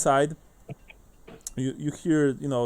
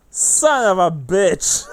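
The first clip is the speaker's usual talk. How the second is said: slow, louder outburst a bit higher; an outraged guard exploding; mock angry curse; 5 semitones higher, 8 dB louder, slower than usual. Guard swears at the message.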